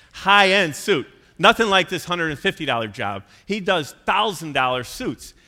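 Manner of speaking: loud, high emphasis; emphatic. Real, not acted. Preacher booming to the congregation about thousand dollar suits. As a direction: punch out how pricey the suits are.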